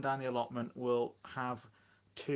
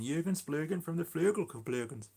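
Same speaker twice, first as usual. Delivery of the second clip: higher-pitched comic film-character voice; mock film-character impression, pitched up; Mike Myers film quote; 4 semitones higher than usual. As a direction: do the silly movie line in a put-on voice. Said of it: "it's definitely a Mike Myers".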